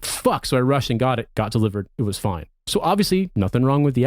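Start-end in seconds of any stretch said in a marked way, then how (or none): 0.0-0.5 startled curse. Retold or imitated. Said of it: "I was like".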